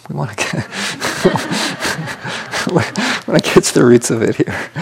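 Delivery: laughing through the words. Real, not acted. Teacher joking to students about later Buddhism, laughing. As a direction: let a real chuckle break up the line. Amused aside, laughing mid-sentence.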